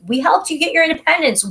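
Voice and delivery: hammed-up French complaint, up high and fast; mock indignant French. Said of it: "And the French are like".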